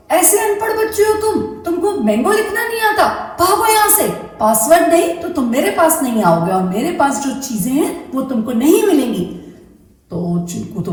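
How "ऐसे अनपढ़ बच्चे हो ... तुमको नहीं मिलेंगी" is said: high, fast scolding shout; angry mango shouting. Acted. Mango yells at illiterate Chinku, high and fast. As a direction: shoo the boy away in a rage. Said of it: "mango बहुत जोर से चिल्लाया".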